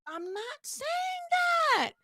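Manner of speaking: high, very slow yell; drawn-out frustrated shout; exasperated shout. Real, not acted. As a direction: yell it over them, fed up. Cutting off the imagined viewers.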